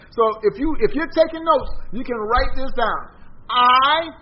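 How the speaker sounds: ringing pulpit delivery; fervent preaching. Acted. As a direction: preach it out to the congregation. Preaching at full force.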